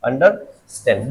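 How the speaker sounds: under and stand said slowly, pulled apart; two separate words. Shown as a demonstration. Teacher showing the verb's two words, slowly split. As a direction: pause between under and stand, drawing each out. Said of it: "दो शब्द हैं इसमें".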